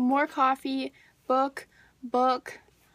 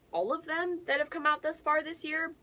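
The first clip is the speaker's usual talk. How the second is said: ordinary talk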